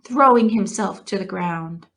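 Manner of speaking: ordinary delivery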